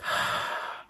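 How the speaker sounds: long tired sigh; heavy sigh. Real, not acted. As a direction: let out a weary sigh. Struggling to breathe, sighs.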